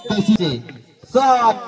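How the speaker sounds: high, loud rising call; excited commentary. Real, not acted. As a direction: build the attack up high. Counter attack chance.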